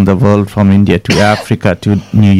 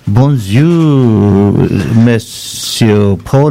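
bonjour with a French accent; much slower than usual. Real, not acted. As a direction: greet him the French way, slowly. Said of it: "a little bit in my French".

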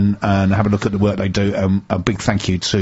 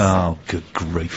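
exasperated; 3 semitones lower, 5 dB quieter, much slower than usual. Real, not acted. Mock despair repeated.

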